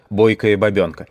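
ordinary delivery, nothing marked

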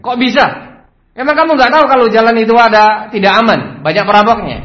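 high, loud rapid scolding; acted heated interrogation; angry boss. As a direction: grill the worker in outrage. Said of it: "tambah marah lagi dia".

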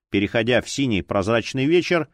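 ordinary delivery

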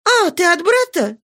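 high, eager cry of relief; suddenly lifted, relieved question; relieved exclamation. A narrator's reading. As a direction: pitch it up, hopeful and relieved. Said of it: "Со вздохом облегчения она вскричала".

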